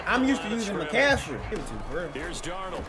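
ordinary talk

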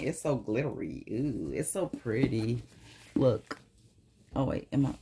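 usual speaking voice

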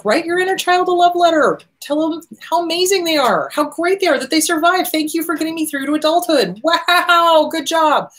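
high, cooing encouragement; gushing praise. Acted. Telling the inner child they did great, high gushing praise.

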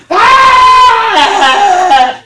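put-on high, loud child wail from the playroom; imitated kid cry. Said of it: "then you would hear a".

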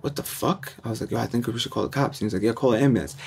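serious, hushed. Retold or imitated. Teens realizing it's serious, hushed worry. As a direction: drop the joking, quiet and worried. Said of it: "when shit got serious".

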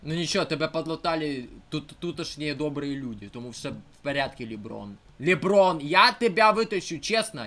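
ordinary talk, nothing marked

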